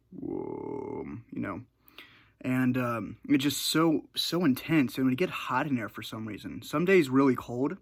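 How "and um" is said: ordinary delivery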